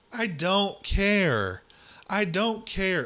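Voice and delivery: high, drawn-out exasperated outburst; fed up, pitch leaping up, dragging the words. Real, not acted. Podcast host dismissing a newspaper headline, exasperated.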